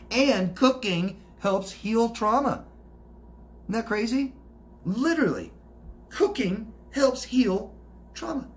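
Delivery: high, drawn-out emphatic delivery; amazed emphasis. Real, not acted. Host marvels that cooking helps heal trauma, amazed emphasis. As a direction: hammer it home word by word, pitched high.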